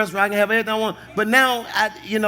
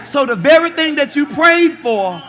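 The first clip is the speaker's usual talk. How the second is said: full-force preaching; 5 semitones higher than usual. Acted. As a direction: press it on the congregation.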